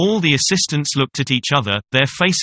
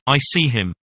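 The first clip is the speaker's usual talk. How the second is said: ordinary delivery, nothing marked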